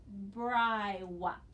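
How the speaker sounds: Bri whop, syllable by syllable; modelling the pretend word Bri whop; sounding out the word. Shown as a demonstration. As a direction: read Bri whop aloud for the class, slow and clear. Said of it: "I'll read this time with you".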